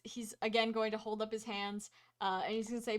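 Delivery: ordinary delivery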